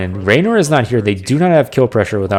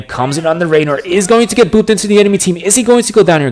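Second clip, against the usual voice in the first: excited; 8 semitones higher, 3 dB louder than usual. Real, not acted. Big play, caster gets excited.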